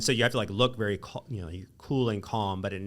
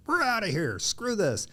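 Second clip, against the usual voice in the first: much higher, dismissive exit line; fed-up quitting; 11 semitones higher than usual. Retold or imitated. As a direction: toss it off like walking out the door. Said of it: "everyone's gonna be like".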